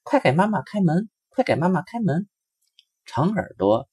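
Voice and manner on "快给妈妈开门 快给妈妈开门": put-on much higher eager child voice; Short Tail urges opening the door, high and eager; eager little rabbit. A narrator's reading. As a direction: hurry them to open up. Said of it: "一边跑一边说".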